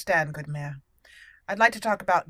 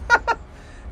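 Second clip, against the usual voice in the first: high breathy giggle. Real, not acted. Laughs at green ooze.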